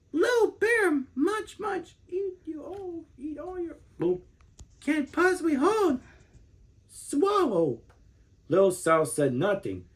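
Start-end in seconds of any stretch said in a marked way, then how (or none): 0.1-7.8 much higher, mumbling through a mouthful of berries; a mother bear talking with her mouth stuffed; mother bear, mouth full. A narrator's reading. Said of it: "and she said".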